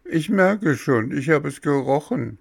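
put-on high-pitched, protesting child figure; high, fussy boy stalling over medicine; whiny puppet boy. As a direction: voice a stubborn little patient, high and insistent. Voices Bengele in the story.

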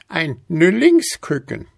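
„Nüllingsküken“ hoch und laut in Altweiberstimme; „Nüllingsküken“ keck betont von der Alten; alte Frau. A narrator's reading. Alte nennt den Vogel. (hohes, dreistes „Nüllingsküken“)

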